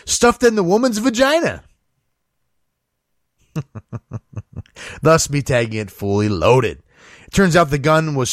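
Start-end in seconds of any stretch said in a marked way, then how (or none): 3.5-4.6 quiet stifled chuckle; soft laugh. Real, not acted. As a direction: laugh under your breath at the absurdity. After the gun story.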